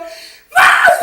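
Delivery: loud, very high mock shriek; drawn-out high squawk; imitated child's outburst. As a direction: shriek like a kid blaming a parent. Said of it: "이러는 거예요, 막".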